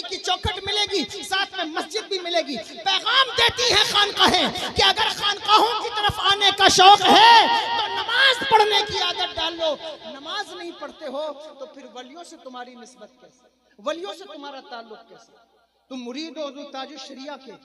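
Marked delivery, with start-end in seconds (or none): 2.8-9.7 loud, higher fervent climax; preacher calling followers to prayer, loud and high; climactic preaching. Real, not acted. Sermon climax. 10.1-17.5 soft, lower rebuke; preacher quietly questioning disciples' bond, soft; hushed reproach. Real, not acted. Sudden drop after climax.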